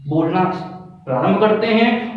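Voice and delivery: ordinary delivery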